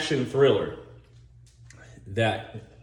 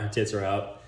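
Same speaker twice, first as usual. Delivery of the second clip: usual speaking voice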